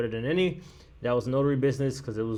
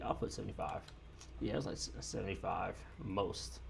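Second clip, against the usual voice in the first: muttering to self; 6 semitones lower, 15 dB quieter than usual. Real, not acted. Muttering to himself while writing.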